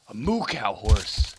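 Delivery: loud, slow delighted exclaim; loud delighted discovery. Real, not acted. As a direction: savour it with delight. Delighted by a spotted horse.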